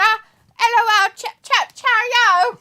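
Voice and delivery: put-on loud, high, drawn-out London accent. Host reads out John's chat message, in a hammed-up London accent. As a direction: holler it like a cartoon Londoner. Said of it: "You sound like you're from London".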